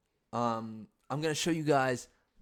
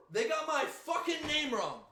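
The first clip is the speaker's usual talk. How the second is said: high indignant shout; outraged yell; 14 semitones higher than usual. Real, not acted. Comic ranting to viewers, high indignant yell. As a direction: let the outrage burst out.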